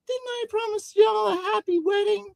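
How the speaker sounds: high character voice, missing the twang; LaFranc. Acted. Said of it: "I forgot the twangy accent".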